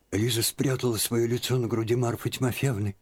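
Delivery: ordinary delivery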